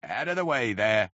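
restrained narrated bawl; a coachman's call, held back in volume; mild bawl. A narrator's reading. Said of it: "bawled a coachman".

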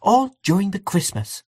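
high, pointed complaint; arch. A narrator's reading. Aunt Kate on Mister Brown's constant presence, arch. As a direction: lift the pitch, archly exasperated. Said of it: "in the same tone".

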